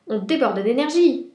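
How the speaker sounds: boisterous big-cat voice; cheetah character. A narrator's reading. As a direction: burst out like an overexcited cheetah. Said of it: "dit le guépard".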